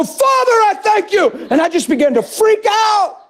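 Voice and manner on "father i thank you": fervent shout. Retold or imitated. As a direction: cry out Father at full pitch. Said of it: "And I go".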